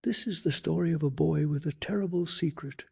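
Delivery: plain reading voice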